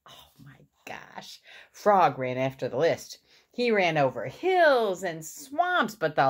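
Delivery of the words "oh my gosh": hushed whisper of disbelief; whispered aside. Real, not acted. Reader to child after Toad's outburst, whispered aside. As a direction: whisper it under your breath.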